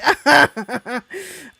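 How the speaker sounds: high laugh; cackling laugh. Real, not acted. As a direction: cackle like a witch. Laughing after calling herself witch.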